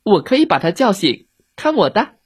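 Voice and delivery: put-on high, eager child's voice; confident little girl. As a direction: offer boldly, like a little girl.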